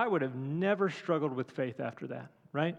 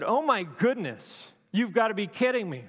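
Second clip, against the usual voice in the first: mock exasperation, raised and loud; exasperated outburst; 5 semitones higher, 4 dB louder than usual. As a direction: throw up your hands, fed up. Imagined exasperated Jesus.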